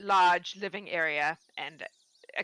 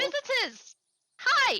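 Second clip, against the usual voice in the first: much higher and louder, gushing; overexcited old woman; 4 dB louder, slower than usual. Acted. Lonely farm wife greets the visitors, high and gushing. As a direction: squeal with delight at visitors.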